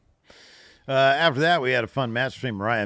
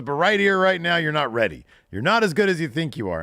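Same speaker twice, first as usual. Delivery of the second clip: higher, louder cutting put-down; mock stern Jericho; 9 semitones higher, 7 dB louder than usual. Host voicing Jericho telling Hook he's not ready, higher and louder. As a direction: lay it on thick like a harsh mentor.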